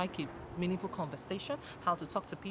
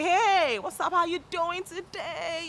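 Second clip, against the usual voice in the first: high-pitched, drawn-out greeting; enthusiastic hail; 10 semitones higher, 4 dB louder, slower than usual. Shown as a demonstration. Showing how you hail your people, enthusiastic greeting. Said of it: "you know, you hail your people".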